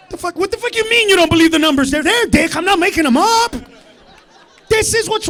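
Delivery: mock outrage. Ranting at a heckler.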